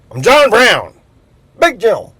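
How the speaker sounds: loud, high brag of a made-up tough guy; hammed-up macho announcement; boastful shout. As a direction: bellow it like a swaggering big shot. Boastful self-introduction as a joke.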